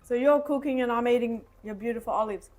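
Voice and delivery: ordinary delivery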